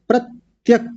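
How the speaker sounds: प्रत्यक said pratyak, short final a, no e; correct pratyak. Shown as a demonstration. Teacher models the right word for students, carefully. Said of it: "अब इसके आगे देखिए प्रत्यक".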